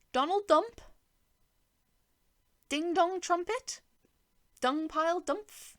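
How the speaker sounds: high, loud mock disbelief; mocking name-mangling.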